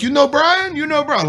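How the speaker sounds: high-pitched imitation of broken English. Old Afghan fighter asking soldiers about his contact, in broken English. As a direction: imitate a halting non-native English speaker, pitched high. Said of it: "He spoke very broken English".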